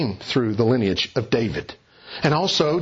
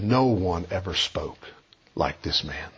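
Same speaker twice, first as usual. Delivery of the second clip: hushed awe; 8 semitones lower, 5 dB quieter than usual. Acted. Preacher quoting the stunned temple officers, hushed and awed. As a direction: lower and softer, almost breathless wonder.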